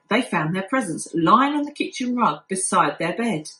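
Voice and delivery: ordinary delivery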